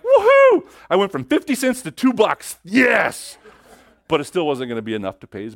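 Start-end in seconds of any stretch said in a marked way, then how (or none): 0.0-3.4 very high, loud cheer; whooping joy. Retold or imitated. Hudson whooping over the half sovereign, high and loud. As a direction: celebrate like a windfall.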